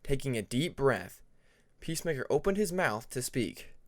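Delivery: plain reading voice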